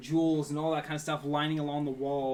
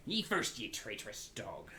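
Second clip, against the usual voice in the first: Captain Isaac; 4 semitones lower, 5 dB quieter than usual. Acted. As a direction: answer as a distrusting pirate, falling in pitch and volume. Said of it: "And Captain Isaac looks".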